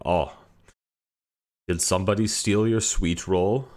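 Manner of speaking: a loud, lightly voiced game guard; Skyrim NPC line. Greeting a day hiker with a Skyrim line, loud NPC voice. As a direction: belt it out like a stock game character. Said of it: "a Skyrim N P C voice line".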